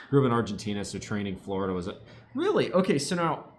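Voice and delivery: ordinary talk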